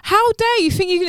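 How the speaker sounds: re-enacted outrage, high-pitched; indignant challenge. Retelling how she challenged the laughing groper, indignant. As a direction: act out telling him off, high and affronted.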